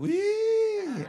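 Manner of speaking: very high, loud "wiii" squeal; mock awed squeal. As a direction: squeal it out in comic amazement. Mock awe at Manado dogs. (pekikan "wih" melengking pura-pura takjub)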